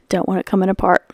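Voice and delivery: ordinary delivery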